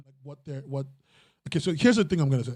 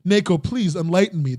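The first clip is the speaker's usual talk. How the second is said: normal talking voice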